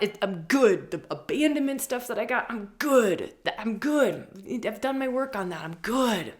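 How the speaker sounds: high, loud, insistent protest; mock denial. Someone who thinks they've healed their wounds, in loud mock denial. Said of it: "the ones that you think".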